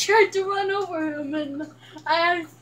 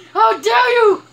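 loud, raised indignant cry; mock outrage; 5 semitones higher, 7 dB louder than usual. Mock outrage during game.

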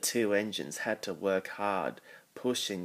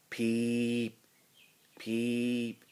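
long, level whistle-like peeps; imitated whistle; 3 semitones higher than usual. A narrator's reading. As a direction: draw each peep out on one held note. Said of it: "he heard a whistling sound".

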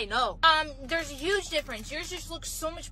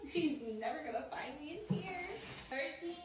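hushed; 10 dB quieter than usual. Acted. Hiding during hide and seek.

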